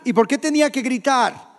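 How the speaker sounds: ordinary delivery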